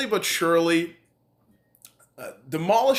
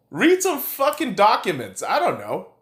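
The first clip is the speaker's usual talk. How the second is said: ordinary talk, nothing marked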